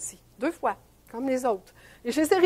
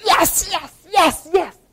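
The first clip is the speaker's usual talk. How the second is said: victory cheer; 2 semitones higher, much slower than usual. Retold or imitated. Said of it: "la première geste qu'ils faisaient".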